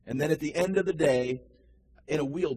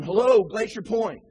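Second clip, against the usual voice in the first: loud call down from a clifftop; hollered greeting; 6 semitones higher, 8 dB louder than usual. Retold or imitated. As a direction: shout it down the cliff like a ranger. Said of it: "those at the top would holler down".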